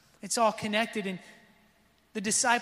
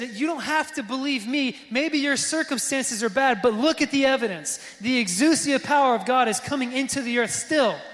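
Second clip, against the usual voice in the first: louder, driving sermon build; preaching at full force; 3 semitones higher, 5 dB louder than usual. Acted. Pastor urging the congregation, louder preaching build. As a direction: push it out to the whole room.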